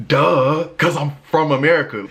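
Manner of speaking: high, loud, stating the obvious; loud and fed up; exasperated. Real, not acted. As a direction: shout the obvious in disbelief.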